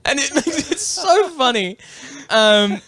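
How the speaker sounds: high, slow words through laughter; laughing. Real, not acted. Recounting the [name] bit, laughing.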